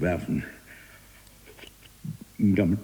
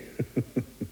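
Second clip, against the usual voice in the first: high, quiet chuckle; short laugh; 6 semitones higher, 5 dB quieter than usual. Acted. Prisoner laughs after his own psalm, quiet chuckle. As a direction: chuckle softly to yourself.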